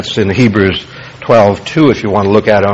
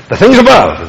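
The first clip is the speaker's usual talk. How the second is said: "things above" raised high and firm; emphatic high start on "things above", falling at the end; stress on THINGS ABOVE; 6 semitones higher, 3 dB louder than usual. Shown as a demonstration. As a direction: punch "things above" as the important words. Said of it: "the emphasis".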